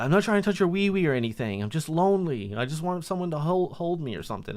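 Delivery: high, needy imitation voice; retold lonely pleading. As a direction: mimic a pitiful man begging for comfort. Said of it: "he said, uh".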